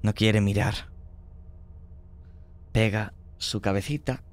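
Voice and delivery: ordinary talk, nothing marked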